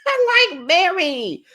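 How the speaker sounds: high-pitched, laughing delight; amused squeal. Real, not acted. Amused aside after retold comeback.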